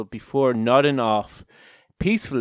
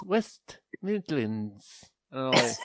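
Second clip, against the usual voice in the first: English accent; 7 dB quieter, slower than usual. Shown as a demonstration. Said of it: "Is that a good English accent".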